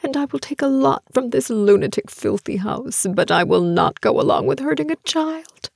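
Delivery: defiance building. A narrator's reading. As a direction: work yourself up from inner defiance towards a yell. Defiant inner speech building to shout.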